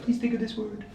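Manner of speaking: high, soft put-on voice; a softly voiced secret request; imagined plotter. Magician imitates someone planting a word before the show, high soft voice. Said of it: "they were like".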